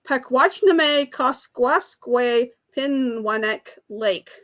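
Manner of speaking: slow, steady sounding-out of "Pakwachname Koskwaskway Pinwanek"; careful long-word reading. Real, not acted. Quiz host reads the hard lake name to players, slow and careful. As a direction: read the long name slowly, syllable by syllable. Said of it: "I don't think I can pronounce it".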